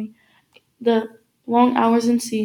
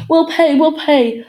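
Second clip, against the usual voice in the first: high, loud, urgent pleading; frantic promise to pay; urgent surrender; 5 semitones higher, 7 dB louder, slower than usual. A narrator's reading. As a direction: give in fast, voice raised. Father gives in at gunpoint.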